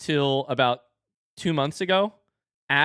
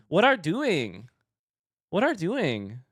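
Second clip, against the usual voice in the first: toddler imitation; 4 semitones higher, slower than usual. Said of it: "She kept saying".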